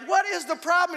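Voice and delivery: high, loud acted question; exasperated demand. Man confronting Taylor about the hose, exasperated high demand. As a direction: demand an answer, at wits' end.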